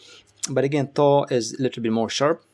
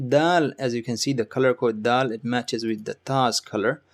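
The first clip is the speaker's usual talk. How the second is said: ordinary talk, nothing marked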